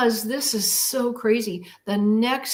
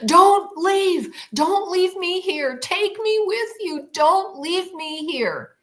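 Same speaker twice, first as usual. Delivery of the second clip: desperate pleading; 10 semitones higher, slower than usual. Retold or imitated. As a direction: beg the craft not to leave you. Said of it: "I'm going".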